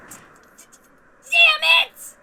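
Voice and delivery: high, loud curse of defeat; a sore loser yelling at the sky; frustrated shout. Acted. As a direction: spit it out as you lose. Loses the cross counter.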